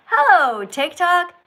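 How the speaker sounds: loud, high, drawn-out hello; enthusiastic greeting. Shown as a demonstration. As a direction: greet the audience with big enthusiasm. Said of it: "with some enthusiasm".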